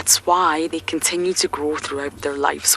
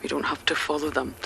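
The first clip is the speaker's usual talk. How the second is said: ordinary delivery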